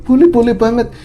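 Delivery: high, loud, overeager yes; keen young man blurting yes. Retold or imitated. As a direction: jump at the offer, far too keen. Sarip eagerly accepts the girl.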